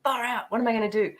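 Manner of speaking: panicked inner thought. Retold or imitated. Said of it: "And I was like".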